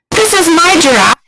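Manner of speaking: loud excited introduction; loud playful reveal. Acted. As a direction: show off the toy proudly. Introducing toy giraffe.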